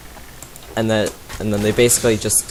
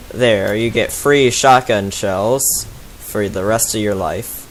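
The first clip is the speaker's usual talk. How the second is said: ordinary delivery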